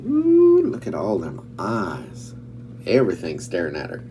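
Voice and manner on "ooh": loud, high-pitched ooh. Acted. Storyteller reacting to all them eyes, high loud ooh. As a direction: let out a big high ooh.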